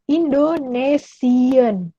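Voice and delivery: "Indonesian" drawn out slowly, German ending -sian; German pronunciation. Shown as a demonstration. Teacher to students, modeling the German word, slow and careful. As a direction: spell out "Indonesian" slowly for the class. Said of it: "dalam bahasa Jerman itu adalah".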